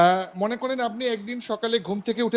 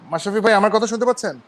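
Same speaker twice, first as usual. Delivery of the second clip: louder, calling over a bad line; to someone hard of hearing; 5 dB louder than usual. Real, not acted. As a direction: speak up so he can hear. Caller can't hear on live call. (জোরে ডেকে বলা)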